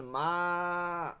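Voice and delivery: মা stretched long like a distant call; calling মা from far away; long drawn মা. Shown as a demonstration. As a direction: pull the vowel of মা out long. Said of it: "এভাবে একটা টান দিতেছেন".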